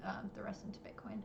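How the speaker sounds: drops to a whisper mid-question; whispered aside. Real, not acted. Interviewer fumbling the question, whispering it to the guest. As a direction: trail off into a whisper, unsure of the wording.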